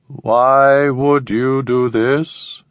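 very slow, high alien question; cold puzzled alien. Acted. Alien asks.

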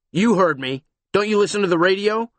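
loud, fast, impatient cop; gruff, impatient. Acted. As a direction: snap it out impatiently. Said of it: "gruff and impatient".

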